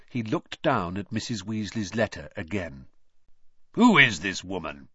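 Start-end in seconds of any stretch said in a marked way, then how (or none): 3.7-4.9 low, loud, disdainful demand; with distaste. A narrator's reading. Uncle staring at the signature, disdainful. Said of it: "staring at the signature with distaste".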